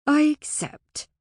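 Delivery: slow, high, loud agreement; emphatic acceptance. A narrator's reading. Fae agrees to Alora's bargain, slow and emphatic. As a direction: draw out the acceptance solemnly.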